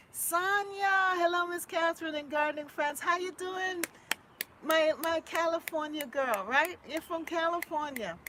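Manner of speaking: high, loud welcome; excited greeting. Real, not acted. Vlogger greeting Sonia and gardening friends, high and loud. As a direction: call out to viewers.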